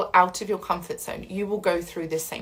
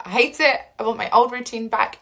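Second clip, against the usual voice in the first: frustrated; 4 semitones higher, 5 dB louder than usual. Retold or imitated. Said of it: "you're like".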